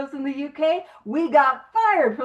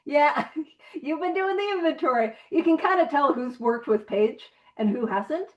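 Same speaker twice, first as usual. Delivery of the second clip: normal talking voice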